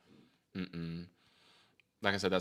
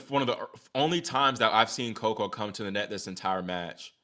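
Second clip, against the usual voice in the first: normal talking voice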